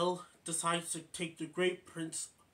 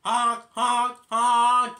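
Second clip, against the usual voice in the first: loud nasal honk honk honk; taxi horn imitation; 7 semitones higher, 15 dB louder, much slower than usual. Imitating Dave's taxi horn, loud and pitched up. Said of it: "That's the sound of Dave's taxi".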